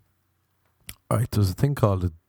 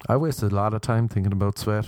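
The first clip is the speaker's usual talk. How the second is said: ordinary talk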